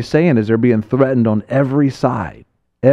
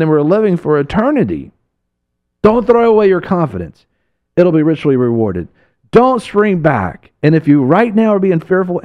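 fiery preaching; 6 semitones higher than usual. Acted. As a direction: preach it to the congregation with fire. Preaching at full force.

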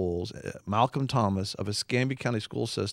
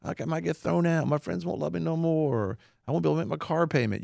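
higher mock complaining excuses; whiny excuses; 6 semitones higher than usual. Host mocking adults' excuses for not standing, lightly whiny. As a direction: mimic adults making lame excuses. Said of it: "these adults give excuses".

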